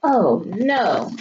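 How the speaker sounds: normal talking voice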